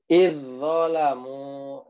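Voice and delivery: slow drawn-out izzolamu with merged z; tajwid recitation. Shown as a demonstration. Teacher demonstrating idgham, slow recitation. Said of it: "Bukan izzolamu".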